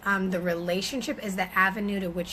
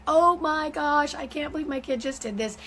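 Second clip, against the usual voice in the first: hammed-up embarrassed mother; mortified mom; 6 semitones higher than usual. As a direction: play a mortified mom at the park. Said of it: "be like".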